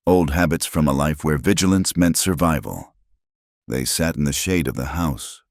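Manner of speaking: ordinary delivery, nothing marked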